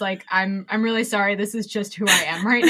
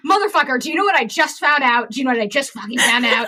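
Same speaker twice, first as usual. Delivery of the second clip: louder, rushing eager blurt; excited rant; 3 semitones higher, 4 dB louder, faster than usual. Retold or imitated. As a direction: burst with news you can't hold in. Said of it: "I'm like".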